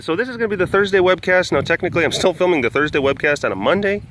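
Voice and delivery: usual speaking voice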